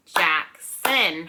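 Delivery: Jackson in two separate, punched beats; clap-along Jackson, one beat per syllable. Shown as a demonstration. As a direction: split Jackson into Jack, pause, son. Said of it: "has two syllables".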